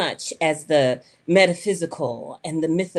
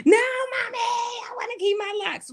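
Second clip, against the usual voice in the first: put-on crying child refusing. Daughter in the hair shop refusing to lose her locks, high crying shout. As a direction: wail it like a kid who won't give in. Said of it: "she's crying and screaming".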